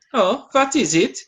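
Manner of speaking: high, mock German accent. Wilhelm asks about the banner, in a high mock German accent. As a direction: squeak out a question in a silly German accent. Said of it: "I need to work on my German accent".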